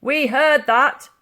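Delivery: loud, slow, high policeman's call; officer through door. A narrator's reading. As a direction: drag it out smugly through the door. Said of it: "came a voice".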